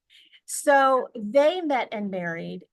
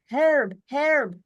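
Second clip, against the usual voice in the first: imitating grandpa's European accent, high and loud; excited old-country European accent, calling out a name; 7 semitones higher, 7 dB louder than usual. Grandpa calling to dad every invitation, European accent. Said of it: "accent from Europe".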